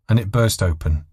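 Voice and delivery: ordinary delivery, nothing marked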